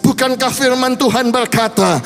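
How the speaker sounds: fervent preaching. Acted. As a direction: preach it with growing fire to the congregation. Preacher builds to altar call. (khotbah berapi-api yang makin keras)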